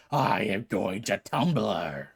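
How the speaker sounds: low slow god voice; Hades voice. Acted. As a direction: drag it out deep like Hades. Hades voice line.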